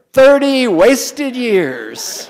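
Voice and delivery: mock lament. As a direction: stretch every word like a mock funeral. Mock-mourning an audience answer.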